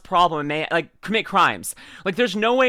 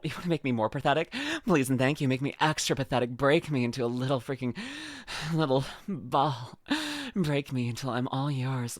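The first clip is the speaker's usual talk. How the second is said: mock soft plea; 4 semitones lower, 7 dB quieter than usual. Pleading softly to Cottontail.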